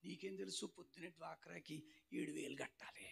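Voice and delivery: very soft, half-whispered worry; hushed confiding. Acted. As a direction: confide it under your breath in church. Confiding money trouble during worship. (గుసగుసగా గోడు చెప్పడం)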